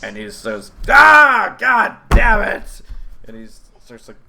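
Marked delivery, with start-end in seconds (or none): 0.9-2.8 loud high furious curse; angry outburst. Acted. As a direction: explode in a shout of rage. Goblin NPC angry at explosion.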